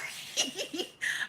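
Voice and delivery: light, breathy laugh; giggle. Real, not acted. Streamer giggling mid-rant, light laugh. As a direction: let a giggle slip out.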